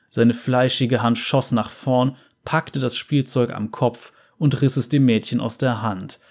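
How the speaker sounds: usual speaking voice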